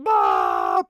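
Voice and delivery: sudden loud low ah; a real startled shout mid-build; startled yell. Real, not acted. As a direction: yelp as something goes wrong.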